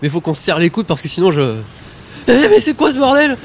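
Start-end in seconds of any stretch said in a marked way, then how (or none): 2.3-3.4 high, loud alarmed cry; panicked surprise. Acted. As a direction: freak out at what you see. Sudden transformation panic.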